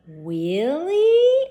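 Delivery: startled at the kindness; surprised. Acted. Elmer surprised that Bugs offers to weed.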